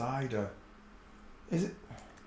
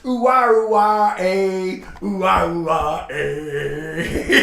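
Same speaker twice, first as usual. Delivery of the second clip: West Country ooh-ah; 3 semitones higher, 6 dB louder, slower than usual. Retold or imitated. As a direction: chant ooh ah like a farmer. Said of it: "He speaks like Wurzel Gummidge".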